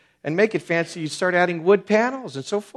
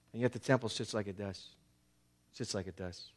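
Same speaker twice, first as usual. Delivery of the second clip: soft, sorrowful repeat; 5 semitones lower, 14 dB quieter than usual. Acted. Sorrowful repeat about ruined temple.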